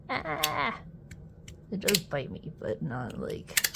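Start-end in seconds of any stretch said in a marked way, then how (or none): none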